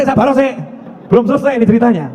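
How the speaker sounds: normal talking voice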